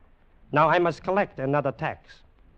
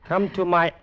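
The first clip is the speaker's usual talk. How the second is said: ordinary talk, nothing marked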